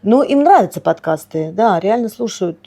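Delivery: ordinary talk, nothing marked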